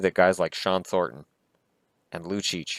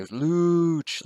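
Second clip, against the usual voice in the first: cheering yell; 9 semitones higher than usual. Retold or imitated. Fan cheering Lucic's play from the couch, high excited yell. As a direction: call it out like a fan in the stands.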